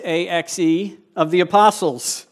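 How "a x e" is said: A X E spelled letter by letter. Shown as a demonstration. Spelling the kids' joke for the congregation, A X E letter by letter. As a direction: spell out A X E, one letter at a time. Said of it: "with the axe".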